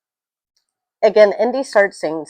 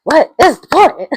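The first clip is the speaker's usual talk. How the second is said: higher and louder, near a yell; second, higher take; more exasperated; 9 semitones higher, 9 dB louder than usual. Real, not acted. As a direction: push the question up in disbelief. Repeats the exasperated question.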